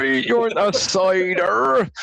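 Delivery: slow, high, drawn-out Somerset drawl; exaggerated, high-pitched Somerset impression; Somerset accent. Shown as a demonstration. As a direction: stretch out a broad Somerset burr. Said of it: "whereas the Somerset is".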